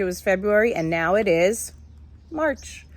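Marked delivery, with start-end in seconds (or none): none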